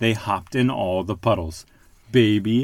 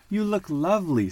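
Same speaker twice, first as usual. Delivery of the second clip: a touch higher, fond; lightly voiced mother duck; 8 semitones higher than usual. A narrator's reading. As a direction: compliment the duckling fondly. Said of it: "said Missus Duck".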